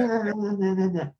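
high, sing-song mocking nah nah nah; mock taunting bum. Storyteller mimics panhandler taunting a woman, high mocking chant.

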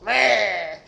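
meh collapsing into a high laugh. Real, not acted. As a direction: crack up on the grunt. Repeating the pirate's meh.

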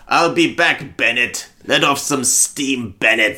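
mock Schwarzenegger accent; sputtering foiled villain. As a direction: growl it like a beaten movie villain.

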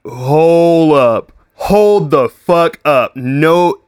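high, loud, slowed-down stunned reaction; alarmed disbelief. Retold or imitated. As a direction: stop her cold in shock. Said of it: "And I'm like".

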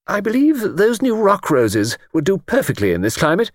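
high, prim lady's voice; Mrs Anstruther voice. Acted.

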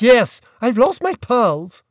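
a grand lady put out by her loss; distressed aunt. A narrator's reading. Aunt voiced in story.

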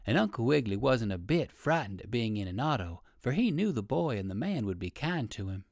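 plain reading voice

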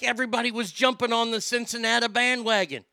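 ordinary delivery

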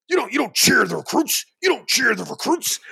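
high, sharp mock scolding; imitating a snap. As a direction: bark it like a stern coach at attention. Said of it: "snaps on me".

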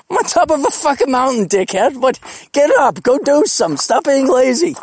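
playfully yelling at a lazy friend from a mountaintop; mock rant. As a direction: rib him with a hammed-up scold.

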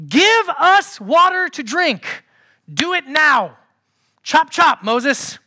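loud, high, impatient order; demanding. Acted. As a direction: bark orders like an impatient crowd.

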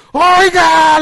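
loud, high cry of disbelief; stunned, hammed-up shout; astonished mechanic. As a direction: call out in acted shock. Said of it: "el mecánico se quedó sorprendido".

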